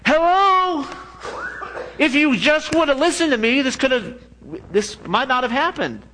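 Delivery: exasperated told-you-so. Retold or imitated. Jeremiah scolding the people who ignored him, high exasperated mock cry. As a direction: wave your arms at people who never listened.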